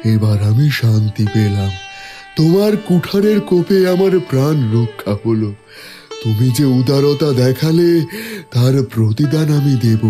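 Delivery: relieved, grateful tree voice; talking tree. Acted. Tree relieved after the cut.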